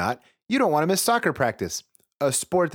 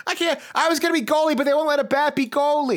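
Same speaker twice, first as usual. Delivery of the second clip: put-on high bat-kid voice; 12 semitones higher, faster than usual.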